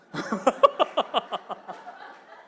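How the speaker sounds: an airy, unvoiced burst of laughter; a short, breathless chuckle; breathy laugh. Real, not acted. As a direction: laugh off the joke. Pastor laughs at own joke.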